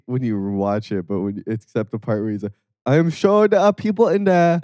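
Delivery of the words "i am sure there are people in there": put-on a light Liberian accent, pitched up; imitating the Liberian man's accent, higher. Friend quoting the burning-man video in Liberian accent.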